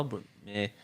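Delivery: ordinary talk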